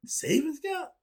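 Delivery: rising mock puzzlement; mock disbelief. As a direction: ask it like a foreign term. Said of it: "I don't know what the hell that is".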